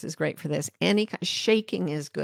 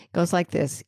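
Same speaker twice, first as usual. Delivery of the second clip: normal talking voice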